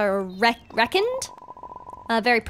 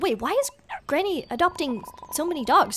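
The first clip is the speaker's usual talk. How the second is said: ordinary talk, nothing marked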